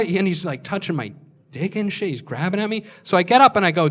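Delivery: ordinary talk, nothing marked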